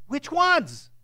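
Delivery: anxious questioner. Acted. Man dodging Jesus' command.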